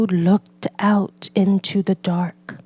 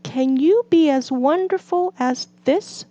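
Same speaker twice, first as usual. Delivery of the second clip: Toad showing off; 7 semitones higher than usual. A narrator's reading. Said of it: "said Toad".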